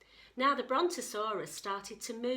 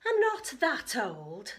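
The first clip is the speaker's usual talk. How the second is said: indignant; 3 semitones higher, 7 dB louder, slower than usual. Acted. Grandma indignant, slow.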